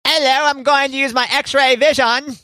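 high, laughing British voice; lightly laughing attempt at a British accent; Henry Cavill British impression. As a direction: play Superman in a British accent. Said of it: "That is not how Henry Cavill talks".

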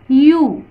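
"यू" drawn out alone with a clear y glide; first-sound demo. Shown as a demonstration. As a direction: isolate "यू" for learners to hear. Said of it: "इसका sound सुनिए". ("यू" की आवाज़ अलग से सुनाना)

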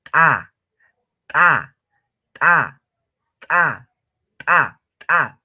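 loud TA, the T popped like something bursting; exploding T. Shown as a demonstration. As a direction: pop the T sharply, no puff of air. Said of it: "como si estarían haciendo reventar o explotar".

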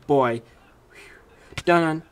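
ordinary talk, nothing marked

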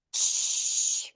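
쉬 as a long unvoiced hush; voiceless sh sound. Shown as a demonstration. Teacher showing a phonetic symbol, long airy 쉬. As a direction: draw 쉬 out in pure air. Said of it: "이 발음은".

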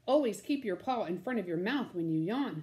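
usual speaking voice

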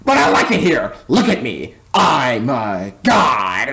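hammed-up character voice, slowing to a drawn-out finish; Squidward. Imitating a self-important cartoon neighbour, low drawn-out ending. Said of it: "I mean, shut up, Squidward".

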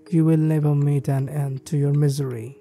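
usual speaking voice

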